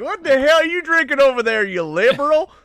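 loud, high mocking yell of a beer-drinking partygoer. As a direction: shout it like a drunk local heckling a stranger. Mocking drunk partygoers yelling at him.

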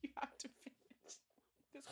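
hushed whisper through laughter. Real, not acted. Teasing her friend to finish the croissant, whispered. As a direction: breathe it, barely voiced.

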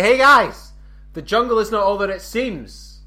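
loud, high announcement to the group; calling out. Acted. Explorer turning round to tell the group, calling out. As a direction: call it out to everyone, pitched right up. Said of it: "I turn around to my, like, say".

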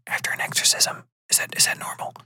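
unvoiced, uneasy whisper; hushed questioning. Host asks about checkups after exorcism, hushed.

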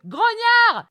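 high, drawn cry down the street; high-pitched acted shout. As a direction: yell it out as if calling across the road. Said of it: "essayé de crier dans la rue".

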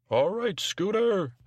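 high, slow put-on operator voice; imaginary phone operator. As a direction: sing it out high and drawn-out, as the operator. Host voices imaginary operator Bob.

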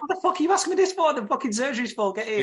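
high, loud mock Dee yelling; fast, high, loud exasperated outburst; exasperated shout. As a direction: snap at him, rushed off her feet. Dee voiced snapping at Darcy.

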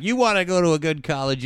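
a hint of a lecturing counselor; guidance counselor. Counselor pushing Marissa toward carnival committee, lightly mock-lecturing. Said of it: "talking to the guidance counselor".

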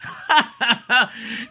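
high-pitched burst of laughter; scandalised laugh. Real, not acted. Host laughing at the text messages he described, scandalised laugh. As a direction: crack up in a high cackle.

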